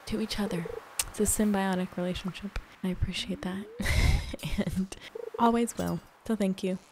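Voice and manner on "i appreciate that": tender, shy whisper; whispered thanks. Real, not acted. Thanking viewers softly.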